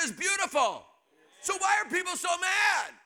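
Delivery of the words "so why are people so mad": higher and louder, pushed out; shouting. Real, not acted. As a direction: shout the question to the crowd. Preacher shouting rhetorical question.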